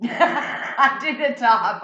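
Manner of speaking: slow and laughing. Real, not acted. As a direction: say it through a laugh.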